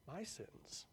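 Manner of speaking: mocking disbelief. A narrator's reading.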